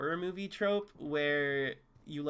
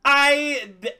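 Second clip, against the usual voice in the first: bursting into disbelieving laughter; laughing loudly in disbelief; 8 semitones higher, 11 dB louder than usual. Real, not acted.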